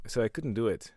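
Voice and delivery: ordinary talk, nothing marked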